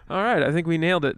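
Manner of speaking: usual speaking voice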